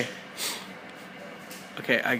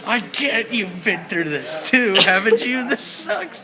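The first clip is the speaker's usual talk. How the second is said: whiny complaint; 7 semitones higher, slower than usual. Pretend. Captive whines to the guard about the cramp. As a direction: moan about the pain.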